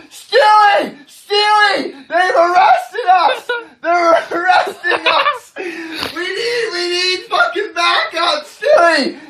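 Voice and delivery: high, frantic mock yell for help; yelling into phone. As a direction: holler desperately for backup. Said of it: "I start yelling into the phone".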